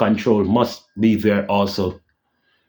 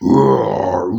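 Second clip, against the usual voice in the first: low, gruff bear-like roar; imitated roar; 4 semitones lower than usual. Fan showing how the Thing sounds, low roar. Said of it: "when you hear him".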